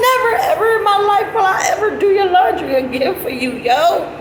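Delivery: high wailing through laughter; real tearful laughing; laugh-crying. Real, not acted. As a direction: squeal it out while crying with laughter. Quote through heavy laughter.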